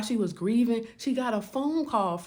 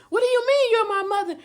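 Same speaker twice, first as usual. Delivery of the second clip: very high and loud; demanding an explanation; stunned outcry; 12 semitones higher, 7 dB louder than usual. Retold or imitated. Daughter shouting at her friend-mother, stunned.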